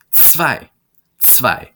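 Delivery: "Zwei" said as "tsvai"; teacher modelling "Zwei"; model pronunciation. Shown as a demonstration. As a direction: repeat "Zwei" clearly for learners. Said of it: "The Z makes a tv sound".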